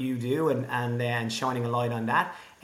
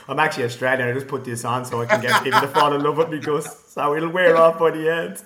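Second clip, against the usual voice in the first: laughing joke; 4 semitones higher, 9 dB louder than usual. Real, not acted. Joking about his accent, laughing.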